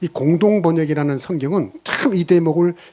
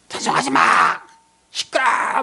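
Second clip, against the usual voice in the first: hoarse, strained mock scream; high-pitched mimicked yell; retold drunken yell; 7 semitones higher than usual. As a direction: imitate a drunk heckler screeching. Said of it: "누군가가 옆에서 소리를 질러".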